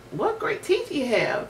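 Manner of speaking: a touch of frightened squeak; squealing. A narrator's reading. Girl seeing the wolf's teeth, mild squeal. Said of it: "she squealed".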